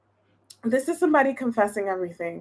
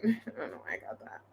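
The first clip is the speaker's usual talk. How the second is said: soft, quick laughing mutter to herself; laughing aside; 13 semitones lower, 14 dB quieter, faster than usual. Real, not acted. Reader laughs at her own odd wording, softly. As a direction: giggle it under your breath.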